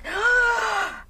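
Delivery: loud, sharp gasp; big reenacted gasp of alarm; shocked gasp. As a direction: gasp as the realization hits. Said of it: "that's when it hit me".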